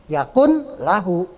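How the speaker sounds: "Yakun lahu" slow, with a clear n; misread as written. Shown as a demonstration. Teacher showing a misreading from transliteration, "Yakun" with n. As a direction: separate "Yakun" from "lahu", sounding the n. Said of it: "salah udah".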